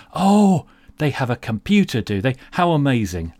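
loud, high mock amazement; sarcastic. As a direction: gush with fake wonder.

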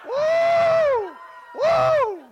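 high, drawn-out "woo"; high whoop. Real, not acted. Preacher rejoicing with the congregation, high whoop. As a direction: let out a high revival whoop.

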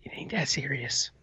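hushed aside under breath; whisper. Retold or imitated. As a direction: whisper it nervously. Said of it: "I was like".

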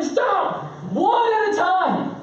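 very high, drawn-out exasperated yell; overwhelmed dad yelling. Retold or imitated. Dad cleaning as three kids ask at once, exasperated mock yell. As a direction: act out snapping at clamoring kids. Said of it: "And I'm just like".

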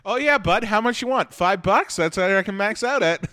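loud, high-pitched sarcastic eagerness; mock generous. Comic answers someone asking to borrow money, mock generous. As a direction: play along with hammed-up generosity.

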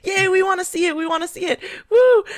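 high, fake fangirl-style cheer; mock eager cheering. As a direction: imitate fawning staff cheering the boss on. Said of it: "like, suck up to him".

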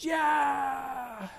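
high shouted yeah; crowd cheer. Acted. Crowd cheers the boy's crossing, high shout.

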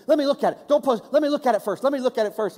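high, loud anxious insistence; mock someone frantic to vet a photo; fussy photo checker.

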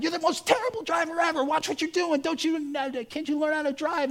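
high, fast ranting yell; imitating a man raging at a driver; mock parking lot rage. Angry man yelling at driver across parking lot, high and fast. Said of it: "with parking lot rage".

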